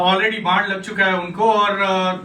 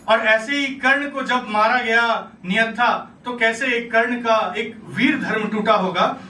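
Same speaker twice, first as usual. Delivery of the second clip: normal talking voice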